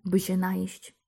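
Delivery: ordinary delivery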